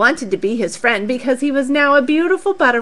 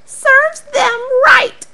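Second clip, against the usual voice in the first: smug; 13 semitones higher, 4 dB louder, slower than usual. Acted. Charlie thinks smugly.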